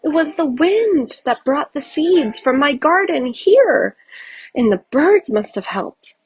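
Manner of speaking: a touch of wonder; delighted. A narrator's reading. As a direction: savour the discovery. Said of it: "knelt in delight".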